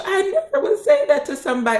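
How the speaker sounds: high-pitched mock crying voice; tearful denial. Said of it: "And she started crying".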